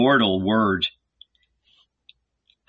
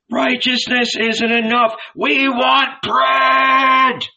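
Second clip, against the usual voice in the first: high, loud, dragged-out demand; angry demand; 14 semitones higher, 4 dB louder, slower than usual. A narrator's reading. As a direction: demand it furiously. Famished crowd demands.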